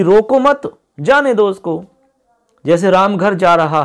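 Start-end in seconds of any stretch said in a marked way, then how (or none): 0.0-1.8 रोको मत high and joined, then जाने दो उसको; pause after मत. Shown as a demonstration. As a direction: break after मत, pitch raised. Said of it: "मतलब ये कह रहे हैं कि".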